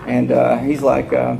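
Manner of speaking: ordinary talk, nothing marked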